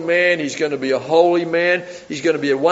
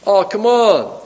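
higher, scornful disbelief; doubting; 5 semitones higher, 4 dB louder, slower than usual. Retold or imitated. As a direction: brush it off in mock disbelief. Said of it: "he responds in doubt".

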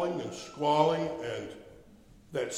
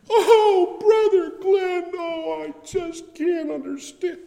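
much higher, louder, slow wailing woman, shouting through sobs; a hammed-up sobbing wife, high and drawn out; hysterical bawling; 6 dB louder, slower than usual. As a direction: play the distraught wife wailing down the phone. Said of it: "And she's bawling".

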